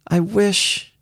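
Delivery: put-on high, lonely child's voice; a small girl's lonely longing; wistful. A narrator's reading. Little girl longing for a companion, wistful. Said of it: "Her voice took on a wistful note".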